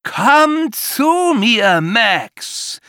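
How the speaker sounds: very high, loud and very slow commanding monster call; the monster summons Max, eerie and commanding; monster's roar. A narrator's reading. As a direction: drag every syllable out like a nightmare creature. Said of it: "brüllt es mit einer Stimme, die keinen Widerspruch zulässt".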